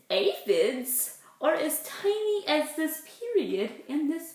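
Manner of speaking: put-on lively read-aloud for little kids; reading to children. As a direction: read it out like storytime. Reading picture book aloud.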